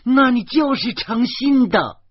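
high, loud and slow shriek. A narrator's reading. Furious man shrieking at the mother, high and loud. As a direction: scream the accusation out. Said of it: "严明尖声地叫起来".

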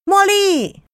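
high, loud happy call; excited greeting. Acted. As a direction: call out joyfully to a friend. Greeting friend at airport.

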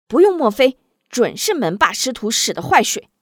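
loud, cutting accusation; angry interruption. A narrator's reading. Han Jing cutting off Ai Wu, loud angry accusation. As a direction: cut him off, furious. Cuts in angrily.

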